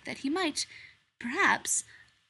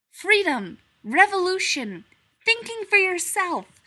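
much higher, louder rallying cry; rousing proclamation; 10 semitones higher, 10 dB louder than usual. A narrator's reading. Strange animal preaching freedom, higher and louder. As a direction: preach revolution to the bear.

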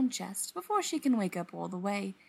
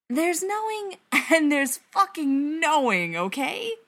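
high, loud, slow emphasis on knowing; drawn-out, pitched-up exasperation; exasperated insistence; 7 semitones higher, 6 dB louder than usual. A narrator's reading. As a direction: lean hard on the second knowing, slowly. Exasperated argument on the phone.

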